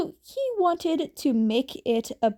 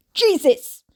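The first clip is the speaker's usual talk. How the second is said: loud, higher jolt of fright; startled yelp; 5 semitones higher, 9 dB louder than usual. Acted. Jason startled by his sister behind him, loud yelp. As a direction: jump out of your skin.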